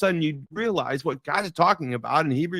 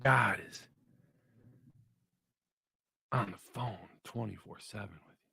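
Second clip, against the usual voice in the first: hushed; 6 semitones lower, 13 dB quieter than usual. Real, not acted. Intimate sermon point, dropped voice.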